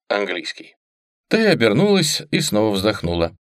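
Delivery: usual speaking voice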